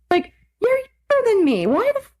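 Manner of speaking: high, appalled disbelief; incredulous rant. Real, not acted. Ranting about how old the ex looks, high disbelief. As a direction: sound genuinely appalled.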